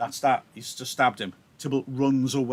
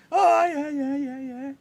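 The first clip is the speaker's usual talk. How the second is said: much higher, mocking brag; bragging Tybalt. Tybalt returning to brag to Romeo, high mocking voice. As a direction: swagger in, gloating. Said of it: "Tybalt comes back as if to brag".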